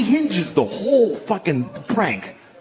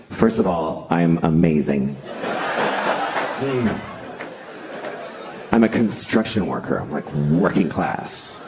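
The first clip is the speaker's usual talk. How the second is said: low, self-impressed tough-guy voice; mock Matt Damon; 12 semitones lower than usual. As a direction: play a smug working-class writer praising himself, deep. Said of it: "he basically sat down and he's like".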